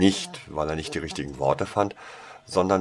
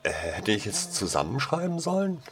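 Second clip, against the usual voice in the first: ordinary delivery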